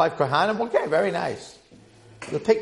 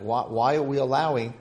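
ordinary delivery

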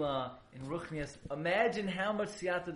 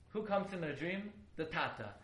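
ordinary delivery

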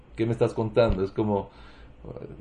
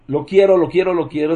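put-on loud, high child's insistent wanting; whiny child demanding; 6 semitones higher, 9 dB louder, faster than usual. Child demanding from the heart, loud and pleading. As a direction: beg like a child who just wants it. Child crying for what he wants.

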